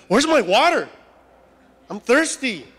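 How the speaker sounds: loud, high mock whine; hammed-up demand from a spoiled grumbler; demanding complaint. Acts thirsty people demanding water.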